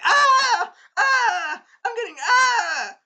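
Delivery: very high, loud mock screams; hammed-up shrieks of someone being shocked; zapped screaming. Acts princess being zapped.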